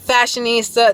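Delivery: normal talking voice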